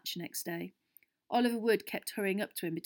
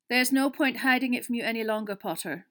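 grave teacher's opening, firm and emphatic; very serious; 4 semitones higher, 7 dB louder than usual. A narrator's reading. Professor to Harry with bad news, very serious. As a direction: break it gravely to a pupil. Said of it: "in a very serious voice".

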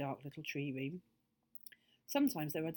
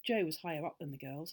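ordinary delivery, nothing marked

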